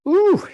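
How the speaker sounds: a sharp, loud, high-pitched "ooh" of surprise; startled high squeal; sudden high ooh. Real, not acted. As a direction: yelp as if something startled you. Sudden interruption mid-talk.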